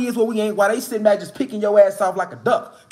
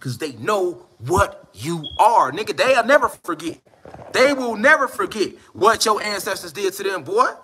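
usual speaking voice

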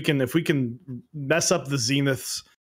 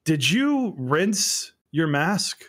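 slow, higher, deliberate questioning; suspicious teacher; 5 semitones higher, much slower than usual. Retold or imitated. Teacher questioning a pupil about a wet mask, slow mock suspicion. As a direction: draw the question out, imitating a wary teacher.

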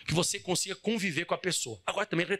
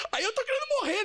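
mock despair. Mock despair at forgiving twice.

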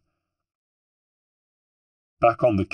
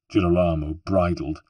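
usual speaking voice

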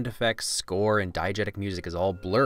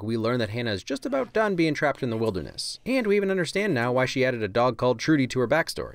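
ordinary delivery

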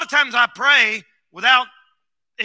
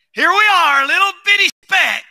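loud, higher preaching cry; preaching shout; 6 semitones higher, 4 dB louder than usual. Real, not acted. Preacher shouting to his church about man's smallness. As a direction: hammer it home to the congregation.

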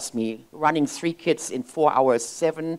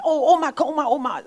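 put-on calling kids; 9 semitones higher, 4 dB louder than usual. Granddaughters calling grandma.

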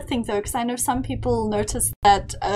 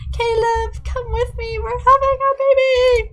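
high, building to a shouted squeal; excited announcement; 10 semitones higher, 6 dB louder than usual. Pretend. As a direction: call out joyfully, ending in a shout. Excited announcement to game character.

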